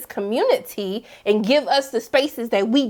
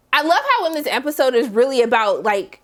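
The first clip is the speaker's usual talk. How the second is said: usual speaking voice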